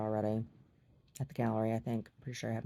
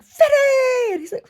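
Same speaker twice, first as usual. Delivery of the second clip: squealed far higher and much louder; excited squeal; 13 dB louder, slower than usual. Retold or imitated. Greeting the excited dog, squealing high and loud. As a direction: shriek it like an overjoyed greeting. Vinny crying, having a fit.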